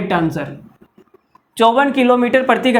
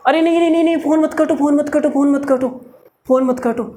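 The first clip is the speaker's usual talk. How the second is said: desperate pleading; 3 semitones higher, faster than usual. Acted. Boy begging her not hang up.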